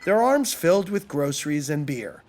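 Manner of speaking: ordinary delivery, nothing marked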